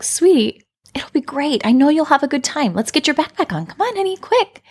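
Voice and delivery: breezy mom waving away the worry, voiced lightly; brushing-off parent. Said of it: "I was the dismissing parent".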